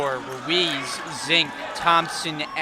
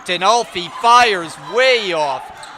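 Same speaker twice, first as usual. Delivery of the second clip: louder, slowed call of the miss; excited call; 4 semitones higher, 8 dB louder, slower than usual. Real, not acted. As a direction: lean hard on the missed shot. Live call of a missed shot.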